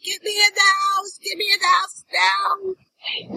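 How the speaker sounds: high, drawn-out howling plea; voicing the cornered dog. Dog cornered by possum begs to come inside, high comic wail. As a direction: wail it like a panicked dog, stretching the last word. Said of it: "And Bonnie was like".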